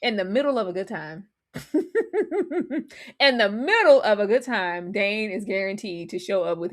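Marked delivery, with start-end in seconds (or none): 1.5-2.8 quick amused laugh. Real, not acted. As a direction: burst of high laughter. Laughing at Dane joke.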